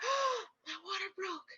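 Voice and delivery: high, hushed astonishment; gasped realization. Retold or imitated. Mother on bathroom floor as waters break, hushed and startled. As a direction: re-enact a soft, startled discovery.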